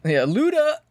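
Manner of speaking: high, drawn-up hype shout of a name; mock hype call. Podcast host echoing the [name] mention, playful high hype call. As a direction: call it out like a rapper's hype man.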